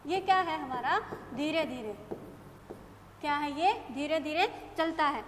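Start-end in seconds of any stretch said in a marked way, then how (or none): none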